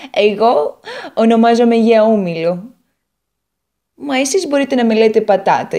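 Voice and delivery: an eager little potato telling her woes; Potato character voice. Acted.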